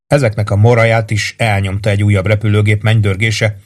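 usual speaking voice

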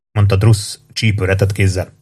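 ordinary delivery, nothing marked

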